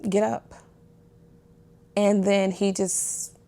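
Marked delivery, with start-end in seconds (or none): 0.1-0.5 softly spoken get up, get up; quiet firm urging, much softer; gentle but insistent order; hushed command. Retold or imitated. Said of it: "And God said".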